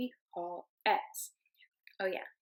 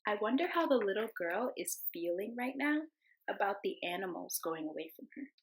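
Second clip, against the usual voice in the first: ordinary delivery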